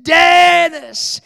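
loud, high, drawn-out shout; acted prayer shout, stretched long; crying out in prayer. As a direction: cry the name out in re-enacted desperate prayer. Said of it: "I began to pray louder and louder".

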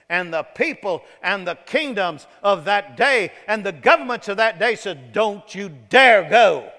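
loud, driving sermon storytelling, punching the warnings; full-force preaching. Acted. Preacher retelling a crowd's warning to the congregation, full-force preaching.

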